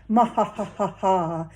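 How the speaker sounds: slow creepy evil cackle; mad scientist laugh. Retold or imitated. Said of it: "creepy laugh that mad scientists do".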